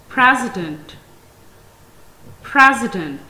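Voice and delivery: "president" with the first syllable strong and clear; stress on first syllable. Shown as a demonstration. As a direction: hit the start of "president", then let it fall. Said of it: "primary stress upon it, as it is pronounced more clearly".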